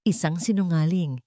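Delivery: usual speaking voice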